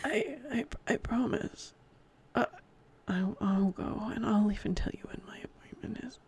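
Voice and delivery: whispered promise. Acted. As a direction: confess it in a near whisper.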